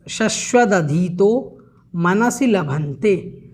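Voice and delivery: शश्वदधीतो मनसि लभन्ते spelled out slowly, syllable by syllable; careful slow pronunciation. Shown as a demonstration. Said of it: "इसका भी उच्चारण आपको एक बार अच्छे से देखना है".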